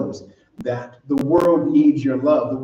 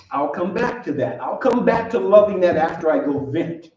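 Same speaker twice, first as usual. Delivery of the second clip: normal talking voice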